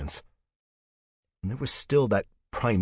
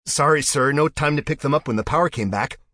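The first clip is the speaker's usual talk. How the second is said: higher, louder rushed apology; panicked apology; 9 semitones higher, 8 dB louder than usual. Acted. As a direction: blurt it out in panic. Officer apologizes amid impending impact.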